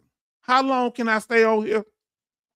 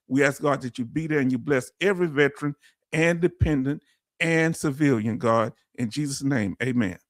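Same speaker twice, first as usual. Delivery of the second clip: lower, hushed earnest prayer; 5 semitones lower than usual. Acted. Streamer prays for Brother Neil's procedure, low reverent prayer. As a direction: pray it low and humble.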